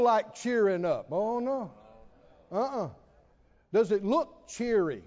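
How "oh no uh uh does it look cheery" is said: usual speaking voice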